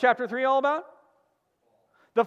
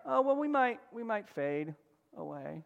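fading, offhand, softer and softer; mock casual shrug; 7 dB quieter than usual. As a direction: say it as a limp maybe. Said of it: "It wasn't like".

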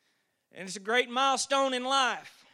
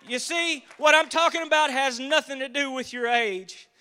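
louder, fervent preaching build; 2 semitones higher, 9 dB louder than usual. Acted. Preacher telling the congregation about Calvary, fervent preaching. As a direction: lift the church with rising conviction.

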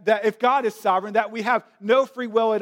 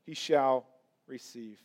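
hushed verdict; 4 semitones lower, 5 dB quieter than usual. Retold or imitated. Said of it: "To which Napoleon responds".

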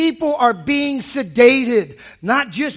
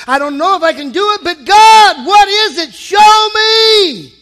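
building to a loud, high cry to God; a mock prayer rising to a shout; impassioned prayer; 5 semitones higher, 8 dB louder than usual. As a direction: plead louder and louder until you cry out. Prayer voiced for congregation.

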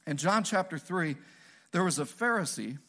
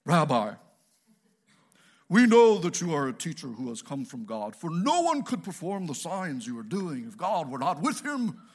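hammed-up holier-than-thou address; pious, prideful; 3 dB louder than usual. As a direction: put on a self-righteous, preachy air. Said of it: "they talked in this pious, prideful way".

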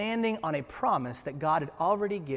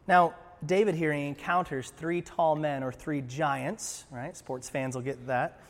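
ordinary talk

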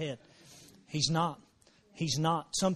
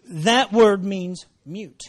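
slow, deliberate; 7 dB louder, much slower than usual. Real, not acted. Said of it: "explains the word slowly".

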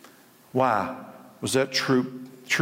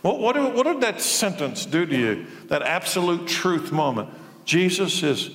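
full-force preaching; 8 semitones higher than usual. Acted. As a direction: hammer the question home to the crowd. Preaching climax to congregation.

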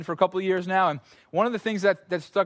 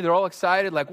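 usual speaking voice

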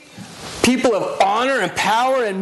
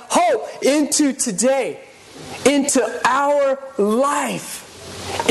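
slow emphatic preaching; 5 semitones higher, much slower than usual. Acted. Preaching climax.

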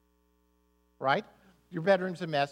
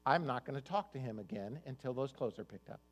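muttered, softer sulky vow; passive aggressive; 6 semitones lower, 5 dB quieter than usual. Retold or imitated. Wife giving husband the silent treatment, softly sulky. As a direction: say it under your breath, sulking. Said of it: "passive aggressive".

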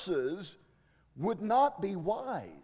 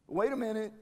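ordinary talk

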